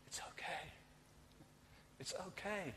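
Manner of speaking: whispered then soft and gentle; God's voice comforting us in His presence, whispered and tender; God's soft reassurance. Acted.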